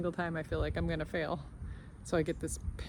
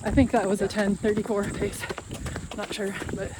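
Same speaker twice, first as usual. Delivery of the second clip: while running; 2 semitones higher, 6 dB louder than usual. Real, not acted. Mid-run update.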